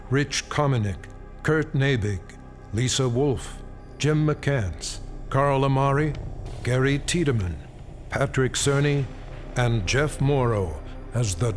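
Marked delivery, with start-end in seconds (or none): none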